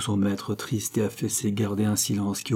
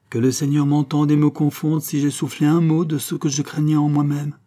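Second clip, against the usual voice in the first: solemn oath; 6 semitones higher, 6 dB louder than usual. A narrator's reading. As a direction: protest innocence, higher and louder. Servant swears innocence to heaven. (serment solennel et véhément)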